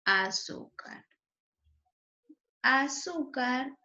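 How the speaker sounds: Azúcar slowly, leaning on the middle syllable; teacher's slow demo of Azúcar, stressed on "zú". Shown as a demonstration. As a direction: draw out "Azúcar" with SÚ as the strong syllable. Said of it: "su sílaba tónica es su".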